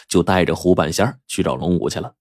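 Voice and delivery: ordinary delivery, nothing marked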